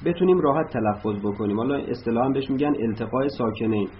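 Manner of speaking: ordinary talk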